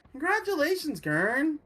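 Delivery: high cheerful congratulations. Acted.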